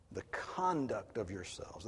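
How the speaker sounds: slow, weight on "conduct" first; stress on conduct. Shown as a demonstration. Preacher showing his flock where the Greek puts the weight. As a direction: lean on "conduct", let the rest fall away. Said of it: "That's the emphasis".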